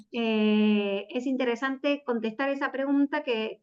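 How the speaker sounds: usual speaking voice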